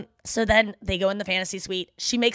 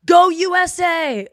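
slow, high, loud chant of USA; cheering for America at a hockey game, loud; mock cheer; 7 semitones higher, 8 dB louder, much slower than usual. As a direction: chant it like a sports fan. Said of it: "she goes".

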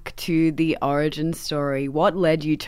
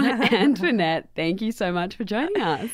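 amused, higher-pitched welcome, laugh just behind it; smile in the voice; 4 semitones higher than usual. Real, not acted. Host welcomes the author after laughing at the book title, smiling voice. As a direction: greet them through a lingering chuckle. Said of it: "I love the smile in your voice".

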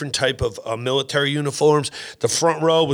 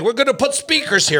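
higher, emphatic general's declaration; commanding general; 10 semitones higher than usual. Retold or imitated. General repeating his plan to sweating comics, mock commanding. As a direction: announce the order like a gung-ho general. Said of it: "And he's like".

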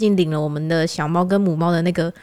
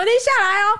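higher-pitched imitation of a fierce uncle in Taiwanese; fierce old uncle; 13 semitones higher than usual. The uncle orders her out of the car, higher mock-fierce. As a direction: bark it like an angry old uncle. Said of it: "全程讲台语哦".

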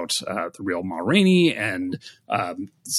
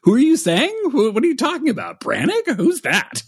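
high-pitched, loud mock bewilderment; hammed-up clueless questioning; comedy-movie confusion; 10 semitones higher, 7 dB louder than usual. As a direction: play a baffled character in a farce, voice pitched up. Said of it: "like, out of, like, a European vacation scene".

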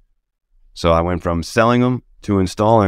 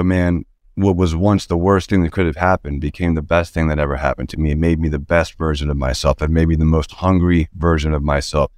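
normal talking voice

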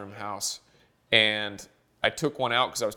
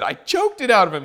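raised, louder mock-angry kid voice; pissed-off kid; 8 semitones higher, 6 dB louder than usual. Son telling mom about the puppy, mock indignant. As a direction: lightly imitate an indignant son. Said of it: "my son gets even more pissed".

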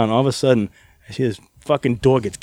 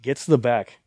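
ordinary delivery

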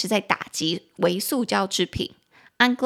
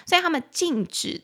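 usual speaking voice

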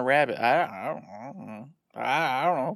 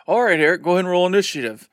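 loud, quick, higher-pitched command; game master's call; 4 semitones higher, 6 dB louder, faster than usual. Retold or imitated. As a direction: imitate a game master kicking things off.

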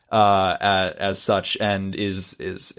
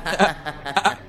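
creepy giggle; 6 semitones higher than usual. Acted. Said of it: "hearing this giggling".

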